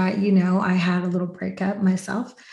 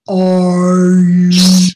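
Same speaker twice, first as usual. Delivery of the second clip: slow, loud drawn-out call; retold AD asking; 2 semitones lower, 9 dB louder, much slower than usual. She retells the AD calling her to set, slow and loud. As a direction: stretch it out, loud, like the AD summoning her.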